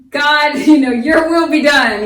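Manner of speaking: very loud, high, slow cry to God; shouted prayer. Shown as a demonstration. Teacher showing students how to shout a prayer, loud. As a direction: belt it out to God, half laughing. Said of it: "You just have to get it out".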